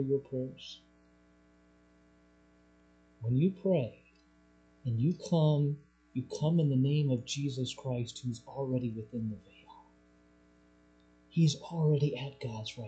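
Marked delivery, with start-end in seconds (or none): none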